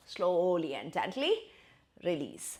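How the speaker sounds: slow, soft instruction to ease off; gentle guidance. Shown as a demonstration. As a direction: talk the class gently out of the pose. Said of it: "yoga teacher guiding release". (धीमी, नरम निर्देश वाली आवाज़)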